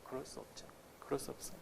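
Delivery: hushed whisper, almost no voice; whispered admission; whispered aside. Real, not acted. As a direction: drop to a whisper as if confiding. Rhetorical answer to own question.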